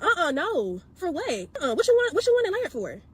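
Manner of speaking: high, sassy "uh-uh" refusal; a hammed-up diva stylist brushing her off; mock sassy stylist. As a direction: scoff like the dismissive stylist. Stylist refusing to layer hair.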